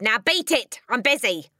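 loud, rough send-off; curt, dismissive father. Acted. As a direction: get rid of the child impatiently. Father dismissing his daughter.

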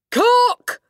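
piercing high, full-force summons; shouting. A narrator's reading. Headmistress calling the cook through the door, shouting. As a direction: yell it across the hall. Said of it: "the Trunchbull shouted".

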